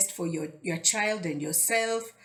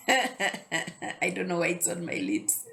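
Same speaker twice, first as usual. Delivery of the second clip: laughing; slower than usual. Real, not acted. Laughing at eyelash mishap.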